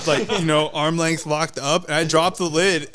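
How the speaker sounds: normal talking voice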